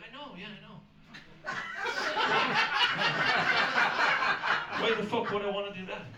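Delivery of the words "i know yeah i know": very soft, offhand admission; unbothered Irish. Acted. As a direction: shrug it off under the breath. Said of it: "Irish people be like".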